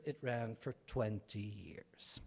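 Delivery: slow, whispered reveal; hushed emphasis. Real, not acted. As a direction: drop to a slow whisper for the punchline. Contrast three years with twenty.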